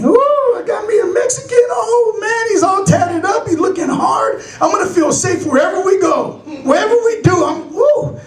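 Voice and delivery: high-pitched gushing; excited girlfriend. Acted. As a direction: squeal with delight like a smitten girlfriend. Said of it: "She's all excited about me".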